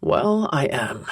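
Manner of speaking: gruff man's voice. A narrator's reading. Gruff male character answers.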